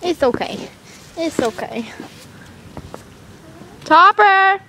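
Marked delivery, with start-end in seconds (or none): 3.8-4.6 calling out. Real, not acted. Calling a horse.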